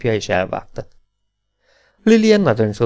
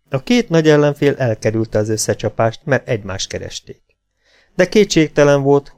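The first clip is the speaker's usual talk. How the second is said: usual speaking voice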